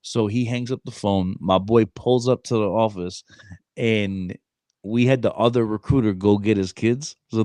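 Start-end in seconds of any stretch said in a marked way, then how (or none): none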